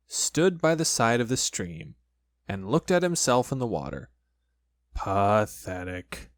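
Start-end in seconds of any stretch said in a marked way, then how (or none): 4.9-6.3 low, very slow gloomy donkey; doleful, slow old donkey voice; Eeyore. A narrator's reading. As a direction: drag it out low and glum. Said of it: "Eeyore, the old gray donkey".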